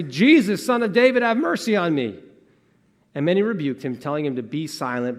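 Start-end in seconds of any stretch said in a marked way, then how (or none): 0.0-2.2 loud, high plea for mercy; crying out. Acted. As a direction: call out urgently over a crowd. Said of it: "he began to cry out and say".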